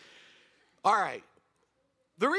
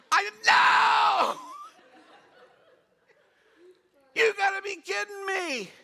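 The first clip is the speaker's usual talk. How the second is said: much higher, louder, slow outcry; incredulous shout; 13 semitones higher, 7 dB louder than usual. Real, not acted. Preacher shouting his disbelief at the crowd. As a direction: cry out in disbelief. Outraged at clapping for march.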